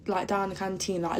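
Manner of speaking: normal talking voice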